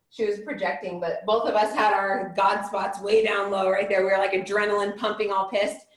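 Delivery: ordinary delivery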